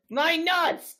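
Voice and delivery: pained yell. Retold or imitated. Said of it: "I hear the guy goes".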